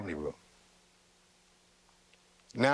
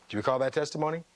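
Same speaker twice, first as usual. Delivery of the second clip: ordinary delivery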